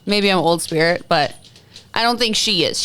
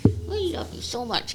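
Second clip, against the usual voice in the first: cooing at the dog; 7 semitones higher, 8 dB quieter than usual. Real, not acted. Cooing at the dog on her lap.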